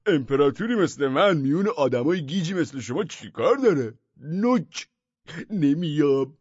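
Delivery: high, loud scornful refusal; haughty madman. A narrator's reading. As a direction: sneer down at them.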